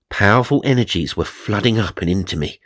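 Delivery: ordinary delivery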